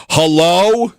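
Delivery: high startled call into the dark; yelled hello. Retold or imitated. Shouting hello at a demon in bed. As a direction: call out as if something's in the room. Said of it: "so I just yelled".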